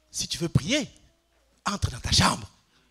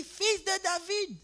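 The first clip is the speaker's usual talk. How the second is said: higher mock cry to the Son of David; a hint of a beggar crying out; retold cry of Bartimée; 13 semitones higher, much slower than usual. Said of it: "il a crié". (cri de Bartimée imité, plus aigu)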